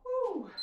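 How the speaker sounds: high, loud "woo" falling away; exertion whoop. Real, not acted. Instructor finishing a set of chops, loud high whoop. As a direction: let out a high whoop of effort.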